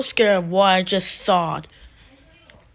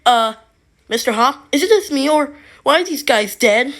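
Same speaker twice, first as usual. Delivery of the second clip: loud, raised acted alarm; alarmed questioning; 5 semitones higher, 9 dB louder than usual. Josiah finds the friends dead, alarmed questioning. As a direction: ask it loudly in mock alarm.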